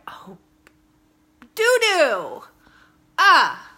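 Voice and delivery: high, loud "doo-doo! Ah!" yelp; startled disgust. Retold or imitated. Mom stepping in puppy pee, loud disgusted yelp. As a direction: shriek as your foot hits it.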